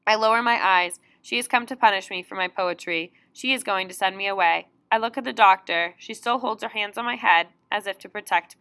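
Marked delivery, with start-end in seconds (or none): none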